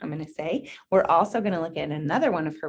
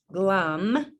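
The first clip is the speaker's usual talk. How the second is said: usual speaking voice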